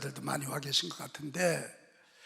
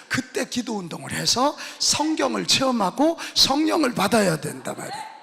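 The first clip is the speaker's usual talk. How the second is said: fervent preaching; 5 semitones higher, 10 dB louder than usual. Acted. Preaching climax.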